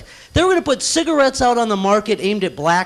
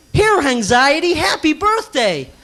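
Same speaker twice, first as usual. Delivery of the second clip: mom voice; 6 semitones higher, slower than usual. Pretend. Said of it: "scenes from the show".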